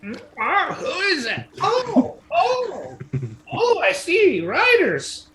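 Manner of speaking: high creaky crone voice; creaky old lady. Acted. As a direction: play a quavering old ferrywoman. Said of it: "creaky old lady".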